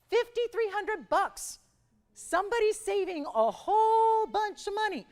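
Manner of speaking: high, loud, slow excited voice; excited squeal. Pretend. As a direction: squeal it with delight. Host hypes viewer's savings.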